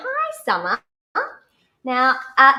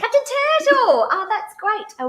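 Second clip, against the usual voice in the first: delighted cry of the name, higher and louder; excited; 10 semitones higher, 6 dB louder, slower than usual. Real, not acted. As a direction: greet the new name with real delight. Host hears the new name.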